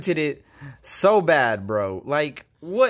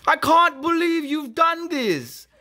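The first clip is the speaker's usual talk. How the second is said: high mock-betrayed; 10 semitones higher, slower than usual. Mock-betrayed line, second take.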